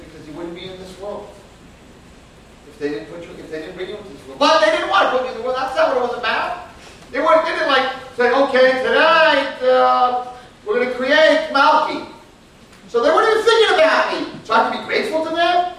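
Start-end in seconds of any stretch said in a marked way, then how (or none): 4.4-15.7 indignant outburst. Real, not acted. Indignant rhetorical objection.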